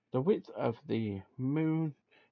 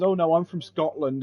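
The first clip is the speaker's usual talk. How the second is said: a hint of a Scottish accent, louder; mock Scottish accent; 4 semitones higher, 6 dB louder than usual. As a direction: play the Australian faking a Scottish accent. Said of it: "starts doing a Scottish accent".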